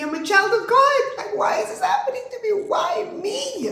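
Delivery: high, loud anguished wail; anguished cry. Retold or imitated. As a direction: cry out to God why me. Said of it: "I cried even more then".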